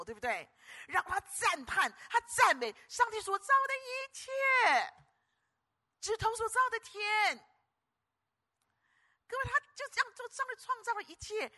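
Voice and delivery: high, slow awed praise; a preacher lost in wonder; rapturous awe. Real, not acted. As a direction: marvel aloud at the heavens.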